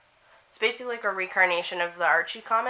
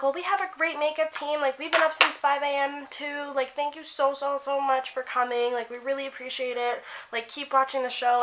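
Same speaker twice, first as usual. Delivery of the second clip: much higher, gushing polite actress; sweet celebrity voice; 7 semitones higher than usual. Retold or imitated. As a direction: imitate the cast thanking a fan sweetly. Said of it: "And they're like".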